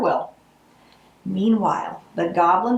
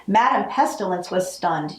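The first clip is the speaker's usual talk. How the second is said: usual speaking voice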